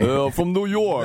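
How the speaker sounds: lightly put-on New York accent; a joking hint of a New Yorker, drawn out; teasing a Brit with a mock New York accent. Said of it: "you gotta start talking like this".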